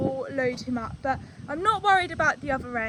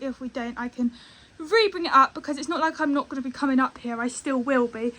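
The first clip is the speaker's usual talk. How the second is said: usual speaking voice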